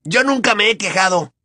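hot-tempered quick reply; heated retort. Acted. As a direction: Ron jumping in to defend Harry. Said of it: "saltó Ron acaloradamente".

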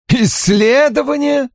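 насмешливый переспрос, высоко; передразнить с издёвкой; с усмешкой. A narrator's reading. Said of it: "с усмешкой вставил племянник". (племянник насмешливо переспрашивает Дэниела)

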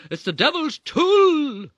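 very high, slow, loud zealot wail; protesting mother. Retold or imitated. Mother protesting to dad about the game, slow high wail. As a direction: stretch it out like a fanatic. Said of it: "she protested".

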